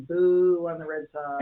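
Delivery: loud playful crowd boo; mock booing. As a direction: boo them like a rival fan. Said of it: "Lois is probably going".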